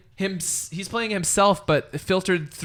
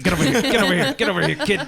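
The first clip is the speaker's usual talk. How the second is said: playful yell; 6 dB louder, much faster than usual. Pretend. Playful grab, pulling someone close.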